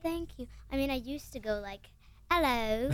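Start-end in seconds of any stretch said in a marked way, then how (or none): 2.3-2.9 loud, hammed-up British hello; terrible British accent. Shown as a demonstration. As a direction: put on a bad British accent. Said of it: "which is a terrible British accent".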